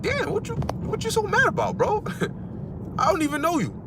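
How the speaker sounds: high, mock-baffled voice; high mock disbelief, laughing it off; mock bewildered reply. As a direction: act baffled at the angry stranger. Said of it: "You're like".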